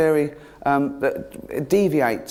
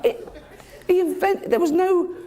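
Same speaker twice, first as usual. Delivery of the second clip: high, gushing mock wonder; mock amazement; 14 semitones higher than usual. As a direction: hype up the miracle like a superfan. Insists light was invented.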